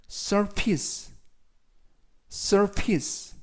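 surface said slowly as SUR-fiss, first syllable stressed; teacher's clear, deliberate read of surface; model pronunciation. Shown as a demonstration. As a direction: model surface carefully for learners to repeat. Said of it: "surface라고 발음하시면 되겠고요".

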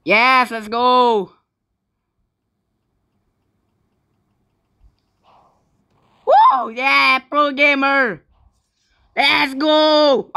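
high, loud, drawn-out victory cheer; excited shouting. Real, not acted. Pro gamer celebrating a win, shouting. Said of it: "When I was shouting, I sounds like a kid".

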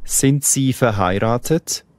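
ordinary talk, nothing marked

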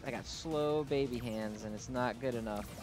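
usual speaking voice

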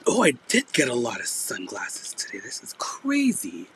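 breaking into a whisper, barely voiced; phlegmy failing voice. Real, not acted. Seller amazed at her sunglasses haul, phlegmy failing voice. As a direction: let the voice give out into whispery croaks. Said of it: "The sexy phlegm".

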